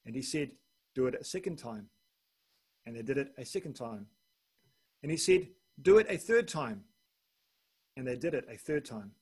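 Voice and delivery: measured, solemn Bible reading; steady preacher reading scripture. Acted. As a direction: read the passage out to the congregation. Reads scripture aloud in sermon.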